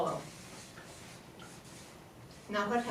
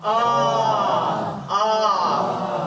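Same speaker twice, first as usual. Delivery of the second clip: louder deep ah; low larynx ah; 6 dB louder than usual. Shown as a demonstration. Teacher modelling the yawn-drop sound, deep ah. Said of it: "and just say".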